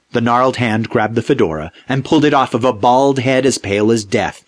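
plain reading voice

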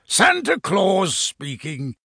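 slow, high-lifted jolly Santa voice; drawn-out, hearty Santa greeting; rumbly, chuckling Santa. A narrator's reading. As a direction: announce yourself grandly as Santa on the telephone. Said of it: "chuckled a rather deep, rumbly voice".

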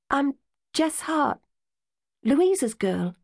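put-on hopeful girl asking a stranger; young Jess. A narrator's reading. Jess introduces herself.

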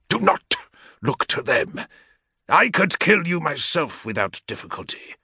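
lightly voiced warlord's aide, measured threat; menacing equerry. Equerry rebuking a petitioner, lightly voiced menace.

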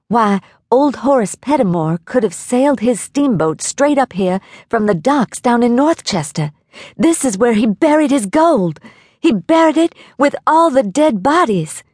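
high, rising excitement in a character voice; excited exclamation. A narrator's reading. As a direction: exclaim with building excitement, pitch climbing. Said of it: "Eddie exclaimed".

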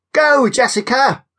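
high cheering shout. Acted. Kira urges Jessica to chase Oliver, cheering shout. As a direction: cheer her on across the field. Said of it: "Kira shouted".

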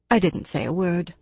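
plain reading voice

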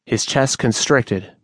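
ordinary delivery

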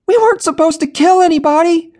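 very high, loud horrified shriek. A narrator's reading. Jenny shrieking at Darren after the killing, horrified shout. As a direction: shriek it in disbelief.

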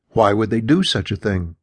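plain reading voice

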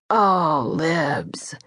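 drawn-out sympathetic coo, softening at the end. A narrator's reading. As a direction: coo it, trailing into a whisper. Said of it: "he cooed".

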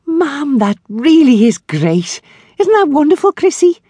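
high, loud burst of delight; excited. Acted. Grace cheering Sadie's news about her son, excited and loud. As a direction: gush over the good news.

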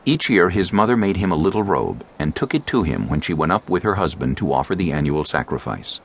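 ordinary talk, nothing marked

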